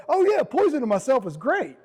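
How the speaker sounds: high-pitched mock delight at yourself; sarcastic enthusiasm. Man ridiculing himself after eating fast food, sarcastic enthusiasm. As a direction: lift the voice high in fake cheer, mocking yourself.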